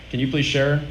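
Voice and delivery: normal talking voice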